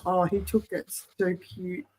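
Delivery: ordinary talk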